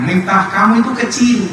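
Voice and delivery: high, commanding divine declaration; voice of God. Acted. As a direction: speak as God humbling a proud man. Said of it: "kata Allah".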